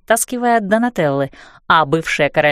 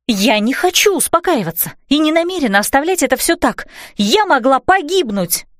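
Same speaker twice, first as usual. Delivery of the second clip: angry protest; 6 semitones higher than usual. A narrator's reading. Heroine refuses, furious.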